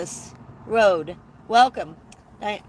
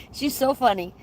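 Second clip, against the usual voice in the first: ordinary talk, nothing marked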